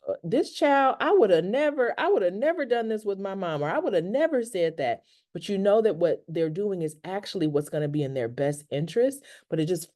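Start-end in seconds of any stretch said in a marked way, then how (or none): 0.1-5.0 exasperated parent's inner voice. Retold or imitated. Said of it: "because we're saying, like".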